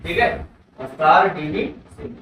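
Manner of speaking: normal talking voice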